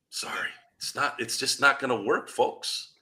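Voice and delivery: half-whispered, dropping the voice away; whispered apology. Real, not acted. Telling Laker fans it won't work, in a whisper. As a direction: let it go voiceless and quiet like a confession.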